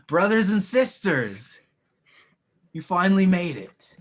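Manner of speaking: loud, mocking villain welcome; villain greeting. Villain mocking the late heroes, loud and sneering. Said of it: "he looks over and he says".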